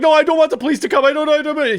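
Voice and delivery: put-on high, fast, frantic child protest; Jonathan panicking. Four-year-old begging his brothers about the police, panicking. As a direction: imitate a small boy losing it, words tumbling out. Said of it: "just panicking".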